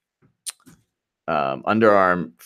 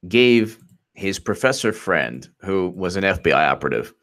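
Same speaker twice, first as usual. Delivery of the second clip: ordinary delivery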